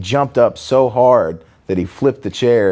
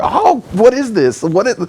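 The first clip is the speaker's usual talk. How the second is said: horrified whining; 12 semitones higher, 3 dB louder than usual. Retold or imitated. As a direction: wail like a tough guy suddenly horrified, hammed-up. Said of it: "they just look at you horrified".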